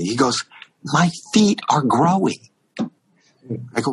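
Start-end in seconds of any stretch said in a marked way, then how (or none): 0.8-2.5 slow, higher, incredulous announcement; alarmed disbelief. Retold or imitated. Pranked singer confesses his worry to comic, slow alarmed disbelief. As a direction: imitate a baffled man, each word drawn out. Retold alarm at shrinking shoes.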